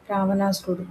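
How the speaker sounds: ordinary delivery, nothing marked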